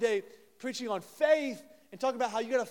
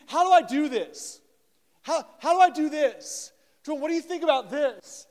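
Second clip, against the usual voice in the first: louder, higher, bursting with eagerness; eager questions; 7 semitones higher, 9 dB louder than usual. Retold or imitated. Josiah asks his pastor about scripture, loud and eager. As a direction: fire the questions out keenly.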